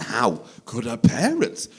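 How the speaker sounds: slow, incredulous, climbing to a high loud pitch; hammed-up horrified judgement, rising as it goes; mock outrage. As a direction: build into appalled disbelief, dragging the words. Acted pre-kids outrage at parents.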